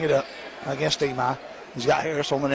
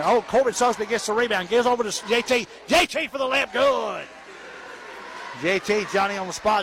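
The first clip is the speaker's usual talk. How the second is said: high, quick excited play-by-play; excited call; 6 semitones higher than usual. Real, not acted. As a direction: call the fast break with rising excitement. Quick rebound and layup.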